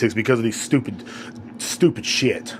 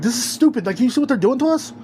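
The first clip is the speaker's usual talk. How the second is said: higher-pitched exasperation; fed-up, raised-pitch protest; exasperated reaction; 10 semitones higher than usual. Retold or imitated. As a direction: quote your own disbelief, pitched up. Quotes own exasperated reaction.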